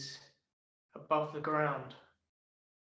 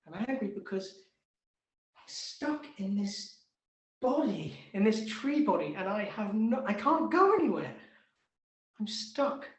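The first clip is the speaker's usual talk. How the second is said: louder, higher frustrated tree rant; angry trapped tree; 5 semitones higher, 9 dB louder than usual. Acted. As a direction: vent like a tree stuck in place. Tree rages at being trapped.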